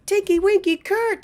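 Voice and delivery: hammed-up high Teletubby chant; silly character voice. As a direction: chirp it like a giddy kids' TV character. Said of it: "but he was like".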